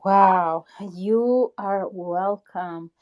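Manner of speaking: normal talking voice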